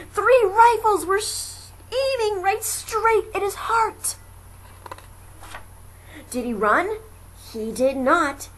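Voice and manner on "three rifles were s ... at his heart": very high, loud alarm. A narrator's reading. Narrator revealing rifles aimed at Horton, high alarm. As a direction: jolt the danger out. Sudden danger.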